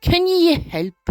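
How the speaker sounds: put-on Scottish accent. Foreman asks a worker at the port for help, Scottish accent. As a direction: put on a Scottish burr, stretching the final question. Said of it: "I don't know why I made him Scottish".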